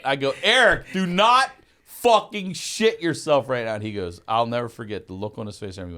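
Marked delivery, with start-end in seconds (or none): 0.4-3.7 high, loud, slow emphatic order; retold desperate warning. Friend ordering the driver to hold it, desperate shout. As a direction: yell the warning, stretching out the words.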